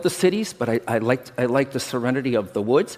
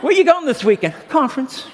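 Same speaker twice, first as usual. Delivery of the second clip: nosy colleague; 12 semitones higher than usual. Acted. Colleague questions, mock dialogue.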